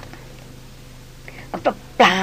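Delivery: a stumbling, faltering denial; stammering. A narrator's reading. Flustered noblewoman denying to the hunter, stammering. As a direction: trip over the first syllable, then deny it. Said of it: "ตะกุกตะกัก".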